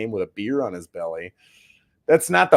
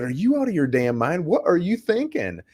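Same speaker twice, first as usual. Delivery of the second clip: rising exasperated scold, higher at the end; retold scolding; 7 semitones higher than usual. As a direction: tell him off in disbelief. Scolding the careless client.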